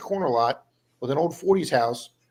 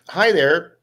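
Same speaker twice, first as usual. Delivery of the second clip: imitating a lady's louder, higher hello; higher, louder quoted greeting; 4 semitones higher, 7 dB louder than usual. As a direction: call it out like the lady walking over. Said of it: "this lady comes out of her house".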